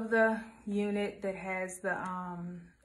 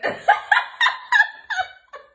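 high, loud laughing fit; losing it completely; real laughter; shrieking laugh; 10 dB louder than usual. Real, not acted.

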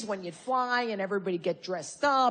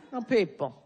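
usual speaking voice

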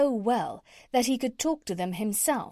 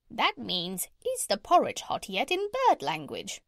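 translate like a pert parrot, pitch shooting up; 3 semitones higher than usual. Acted.

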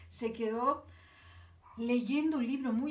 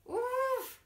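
mock dismay; 12 semitones higher than usual. Reacting to wrong answer.

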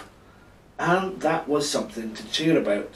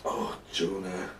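low, soft, slow sigh; 6 semitones lower, 8 dB quieter than usual. A narrator's reading. God sighing at Jonah's complaint, soft and low. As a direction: sigh the name out slowly and softly. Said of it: "God sighed".

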